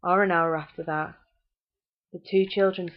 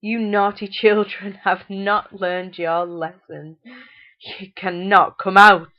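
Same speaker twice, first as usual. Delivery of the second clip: laughing taunt; 8 dB louder, slower than usual. A narrator's reading. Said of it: "their mother laughed".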